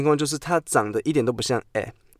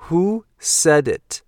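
every word drawn out very slowly; slow model sentence; 5 semitones higher, 3 dB louder, much slower than usual. Shown as a demonstration. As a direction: read it slowly for the class to repeat. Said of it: "model sentence".

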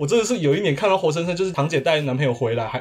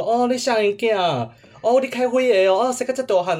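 a lightly voiced nosy old relative, higher; imitating relatives; 7 semitones higher than usual. Relative who never remembers you, lightly mimicked. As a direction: raise the voice a little, chatty relative asking again. Relatives greeting each year.

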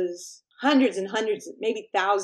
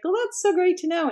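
idol's reply; 10 semitones higher than usual. Retold or imitated. Idol replying kindly.

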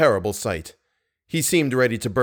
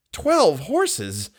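higher, incredulous repeat; surprised echo; 5 semitones higher than usual. A narrator's reading. Manager reacts to the horse count, surprised echo. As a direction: echo it back in disbelief.

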